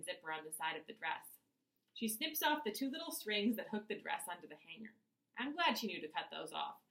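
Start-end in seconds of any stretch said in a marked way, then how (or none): none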